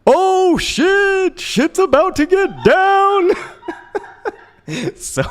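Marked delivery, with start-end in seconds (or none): 0.1-3.3 very high, slow, loud mock-serious squeak; imitating Elsa. Retelling his girlfriend's serious warning in a squeaky high imitation. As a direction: drawl it out in a tiny high voice, dead serious. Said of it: "she was, like, really serious".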